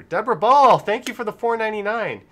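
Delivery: high, loud thank-you; donor shout-out. Real, not acted. Streamer thanks a viewer for a donation, loud shout-out. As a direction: call out the donor's name big and high.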